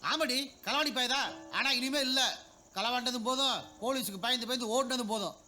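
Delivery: husband snapping back at his wife, angry and loud; angry outburst. Acted. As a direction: bark back at her taunt. Husband flares up at wife's taunt.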